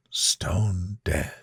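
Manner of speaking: low final whisper. A narrator's reading. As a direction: stone dead, voiceless and cold. Narrator repeats stone, second whispered.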